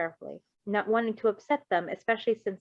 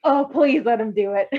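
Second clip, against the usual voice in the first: imitating eager begging; 3 semitones higher, 7 dB louder than usual. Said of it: "just like".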